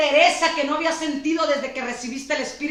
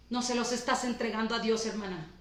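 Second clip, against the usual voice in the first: much softer and lower, almost under the breath; soft rebuke; 4 semitones lower, 9 dB quieter than usual. Real, not acted. Pastor to a discouraged sister, soft tender rebuke. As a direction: gently confront her.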